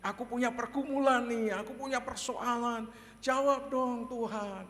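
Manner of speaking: high-pitched bold plea; Daud. Acted. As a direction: cry out a complaint to God. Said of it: "dia berani mengatakan". (mengadu dan memohon kepada Tuhan)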